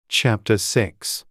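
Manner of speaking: usual speaking voice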